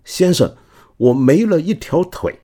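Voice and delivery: high, loud startled cry; exclaiming in shock. Retold or imitated. Earl crying out to Wellington, high loud shocked exclamation. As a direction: blurt it out like you've just been hit. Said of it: "就惊呼".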